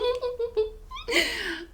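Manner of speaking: a bright burst of real laughter; a high, delighted laugh. Real, not acted. Happy laugh after wedding joke.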